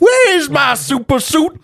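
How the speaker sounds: high, slow frantic yell; Frozone shouting. Retold or imitated. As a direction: yell in a panic over a lost suit. Quoting Frozone yelling.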